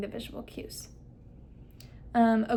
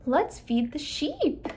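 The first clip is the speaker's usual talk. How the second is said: slow and higher, stressing "sheep"; emphasis on SHEEP; 5 semitones higher, slower than usual. Shown as a demonstration. Clinician playing with the child, emphasizing SHEEP. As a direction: stretch the line and lift "sheep". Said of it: "emphasizing those important words".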